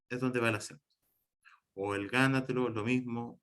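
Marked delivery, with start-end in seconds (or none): none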